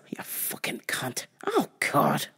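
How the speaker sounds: hushed, breathy insult under the breath; whispered curse. Real, not acted. Muttering a whispered insult at the rude customer. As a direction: spit it out in a whisper so she can't hear.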